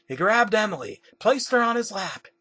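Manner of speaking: ordinary delivery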